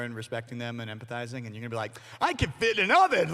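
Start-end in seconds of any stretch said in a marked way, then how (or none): 2.2-3.3 high, loud mock-kid boast; excited kid. Kid who heard only the oven joke, excited blurting. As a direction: blurt it like an overexcited child. Mocks kids missing the message.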